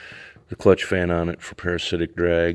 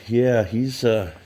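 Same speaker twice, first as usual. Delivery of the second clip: normal talking voice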